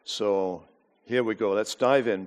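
ordinary talk

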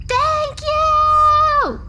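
high, loud cheerful cactus shout. Acted. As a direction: shout it up bright and high like a happy plant. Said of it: "it shouts".

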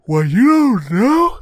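talking through a big yawn. Real, not acted. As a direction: stretch the words out mid-yawn. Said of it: "Sorry about the yawn there".

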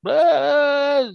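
high mock scream of fleeing diners. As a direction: yelp it high like a cartoon crowd. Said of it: "People, like".

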